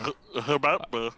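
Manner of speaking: garbled stammer as if round a golf ball; mouth full. Real, not acted. Podcaster fumbling a reply to cohost, mouth-full garble. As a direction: stumble through it with a mouthful. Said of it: "had a golf ball in my mouth".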